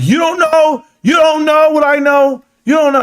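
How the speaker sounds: high, loud sing-song you don't know; gleeful taunt. Retold or imitated. Husband answering his wife, high gleeful taunt. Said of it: "I'm like".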